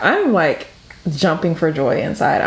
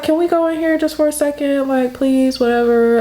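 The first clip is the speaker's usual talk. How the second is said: put-on high, pleading teen whine; whiny pleading; 7 semitones higher than usual. Spoiled daughter begging her mom to go into the store, high whiny plea. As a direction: beg like a spoiled kid.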